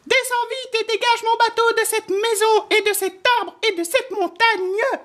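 very high, squeaky mouse voice; little mouse crying out. A narrator's reading. Mouse ordering the wind to push his boat, high-pitched cry. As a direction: pipe up as a tiny mouse giving orders. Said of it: "s'écria le souriceau".

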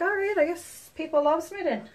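breezy acceptance. Acted. Advising viewers how to shrug off drama, breezy acceptance. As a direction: toss it off lightly. Models a carefree reply to drama.

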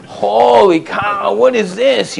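slow, high, hammed-up astonishment; mock shock. Husband walking in on his furious wife, slow mock shock. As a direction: stretch it out like you can't believe your eyes. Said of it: "and I'm like".

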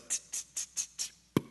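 soft tsk tsk clicks; tongue clicks. Retold or imitated. Dad scrubbing, clicking tongue.

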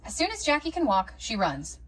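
plain reading voice

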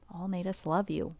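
plain reading voice

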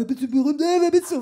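retold pushy blurt; loud brash questioning. Replaying the confrontation louder.

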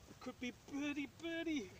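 willing the ball in; excited. Real, not acted. Ball heading for the hole.

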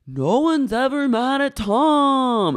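high, slow drawn-out complaint; mock exasperation. Acting out Ariana complaining about Tom, mock exasperation. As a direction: stretch it out high as an imitated grievance.

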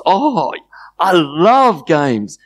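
high, drawn-out gushing; acted delight. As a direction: gush with childlike enthusiasm. Shame delighted by game offer.

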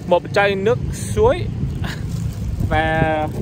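usual speaking voice

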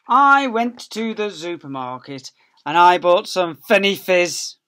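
high, loud, slow storybook voice; reading to children. Acted. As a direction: read it out big and lively for little ones. Reading the picture book aloud.

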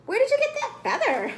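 high, loud squawky hen voice; crackling hen. A narrator's reading. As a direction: demand it like an astonished hen. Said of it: "crackled Henna the hen".

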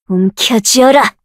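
slow, falling order; slow measured command. Acted. Summoner commanding a creature slowly.

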